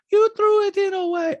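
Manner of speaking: loud high accusing impression; Diana. Diana accusing Sutton, loud high impression. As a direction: mimic her accusing way up high. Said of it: "Diana's like".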